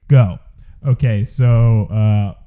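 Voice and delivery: blown-out, booming bass-boosted audio. Pretend. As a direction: overdriven joke bass boost. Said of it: "I'm gonna bass boost it for thirty seconds".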